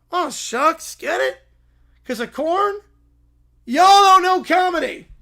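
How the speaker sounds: hammed-up corny catchphrase. Farmhand cracking his corn joke, hammy and loud. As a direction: sell the corn pun like a hayseed comic.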